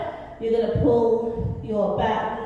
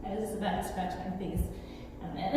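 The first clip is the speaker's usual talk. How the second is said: ordinary talk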